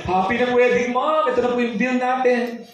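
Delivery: bill them brightly; mock cheery organizer.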